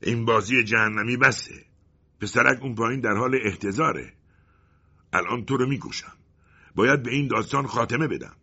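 the colonel threatens to kill the mouse; angry. Acted. The colonel threatens the mouse to end the story, loud and furious. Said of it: "با صدایی خشمگین گفت".

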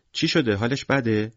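put-on high, small child's questioning voice; concerned little girl. A narrator's reading. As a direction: ask like a worried four-year-old. Little girl worries about penguin.

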